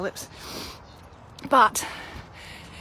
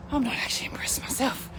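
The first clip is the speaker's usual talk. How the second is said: whispered aside; 3 semitones higher, 5 dB quieter than usual. Real, not acted. Confides aside to camera.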